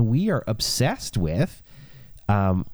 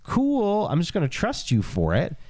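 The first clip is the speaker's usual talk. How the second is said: Abraham's reply; 11 semitones higher than usual. Retold or imitated. Abraham voiced responding to God.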